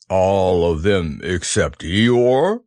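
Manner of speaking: slow, low gloomy donkey; Eeyore voice. Acted. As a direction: drag it out mournfully. Eeyore speaking.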